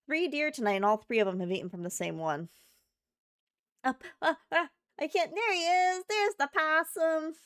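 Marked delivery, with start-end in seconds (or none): 3.8-7.4 high, thrilled cry of recognition; delighted discovery. Real, not acted. Streamer sees the possum reappear on cam, delighted. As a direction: squeal it out, drawing out the possum.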